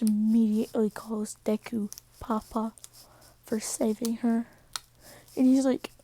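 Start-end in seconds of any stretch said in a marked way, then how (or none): none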